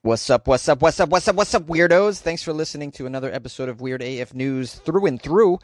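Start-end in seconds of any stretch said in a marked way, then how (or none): 0.1-2.2 hype greeting. Acted. Show sign-off greeting.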